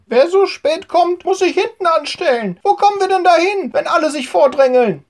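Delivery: a washerwoman telling latecomers off, indignant; hissing washerwoman. A narrator's reading. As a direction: snap at the latecomers. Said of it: "zischt Hanna".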